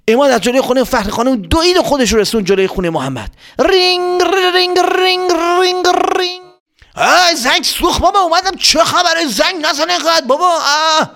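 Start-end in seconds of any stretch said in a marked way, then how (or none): 3.6-6.4 high sing-song ring ring; squeaky imitated bell; mock doorbell ring. 6.9-11.1 put-on much higher old man's grumble, laughing; high grumpy father voice; Mohammad's dad. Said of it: "این صدای بابای محمد بود".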